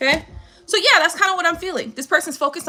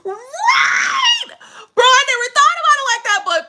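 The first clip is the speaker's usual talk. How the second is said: high, shrieking laughter breaking through the words; laughing; 8 semitones higher, much slower than usual. Real, not acted. Laughing hard at comment.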